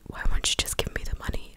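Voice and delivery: normal talking voice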